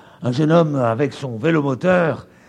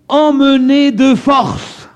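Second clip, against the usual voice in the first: emmener de force said slowly, higher and louder; stressed phrase; 7 semitones higher, 6 dB louder, slower than usual. Shown as a demonstration. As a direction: lean hard on emmener de force. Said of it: "mon dictionnaire grec dit".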